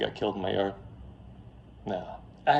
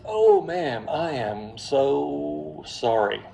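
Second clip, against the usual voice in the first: drawn-out, high-pitched "oh" into a slow apology; hammed-up apology, stretched out; acted apology; 5 semitones higher, 4 dB louder, much slower than usual. Mower apologizing to the dog's owner, hammed-up and drawn out.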